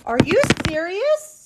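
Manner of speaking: high, incredulous gasp and question; gasp, then pitch the question way up; real disbelief. Real, not acted. Streamer as the setup goes wrong, shocked disbelief.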